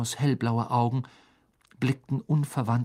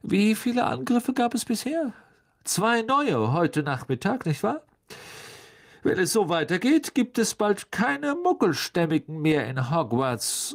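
higher-pitched, smooth reproach; a haughty governor voicing grave concern; Lucius Malfoy; 8 semitones higher than usual. School governor telling the minister things are out of hand, haughty.

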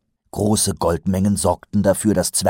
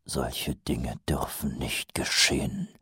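grim whisper; 5 semitones lower, 7 dB quieter, slower than usual. Acted. Mumm's grim vow.

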